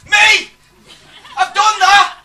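very high shouted outburst; mock eager call-out. Audience man answering the lecturer, imitated eager shout. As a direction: call out from the back of a crowd.

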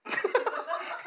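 short unvoiced giggle; breathy laugh. Real, not acted. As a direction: laugh lightly at yourself. Laughs at own military talk. (가볍게 웃음)

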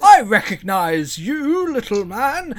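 high, loud, booming storybook train; a grand, pompous children's show engine; cheery cartoon engine. Game master voicing the talking train to players, high booming cheer.

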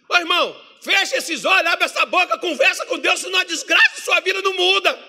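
very high, loud scolding shout; bronca gritada de pastor. Retold or imitated. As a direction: imitate a pastor yelling at the congregation. Pastor yells at passive congregation. (pastor esbravejando, voz aguda e alta)

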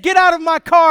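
loud, sharp command; retold angry father; angry order. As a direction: throw him out of the car.

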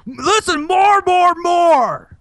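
high-pitched hysterical demand; hammed-up pleading holler; mock demanding shout. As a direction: yell it like a desperate fan. Joins in the parody demanding more.

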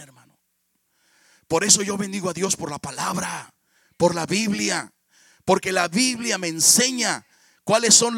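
loud fervent sermon; preaching. Acted. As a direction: preach it with conviction, pressing each point. Predicación enérgica sobre familia. (predicador fervoroso exhortando a la congregación)

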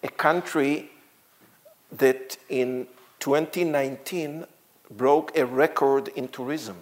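ordinary talk, nothing marked

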